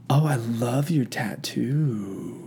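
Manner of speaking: slow, low Southern woman drawl; softly drawled mock Southern accent. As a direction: imitate a Southern woman, stretching every word. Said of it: "Southern woman accent".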